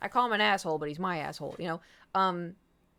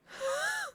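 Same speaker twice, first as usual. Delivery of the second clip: imitated cat gasp. Cat gasps in hallway.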